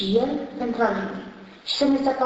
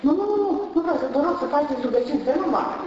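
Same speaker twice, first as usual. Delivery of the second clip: panicked pleading; 6 semitones higher, faster than usual. Retold or imitated. Woman panics, begs for prayers.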